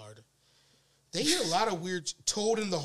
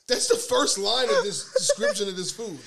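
loud, higher voice breaking into laughter; laughing hard; 5 semitones higher, 6 dB louder than usual. Real, not acted. Host cracks up over the description of the food, laughing. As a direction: protest through real laughter.

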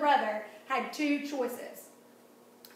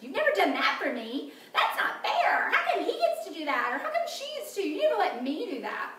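whiny complaint; 6 semitones higher, 4 dB louder than usual. Acted. Acting out a sibling's complaint.

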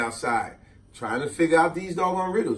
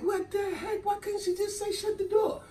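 put-on much higher, softer confused kid; baffled kid; 8 dB quieter than usual. Kid puzzled by the aunt's riddle, high and soft. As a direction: squeak it out puzzled. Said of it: "I'm like".

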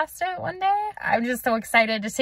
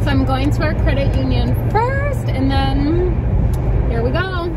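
louder, slow, thrilled announcing; loud excited; 3 semitones higher, 7 dB louder than usual. Real, not acted. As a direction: call it out with excitement.

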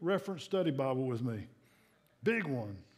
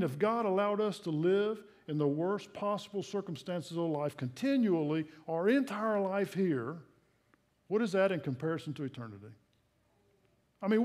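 ordinary talk, nothing marked

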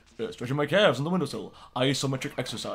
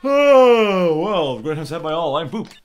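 high, loud fake cheer; flustered loud cover; 6 semitones higher, 12 dB louder, slower than usual. Host faking cheer to cover chaos, loud and flustered.

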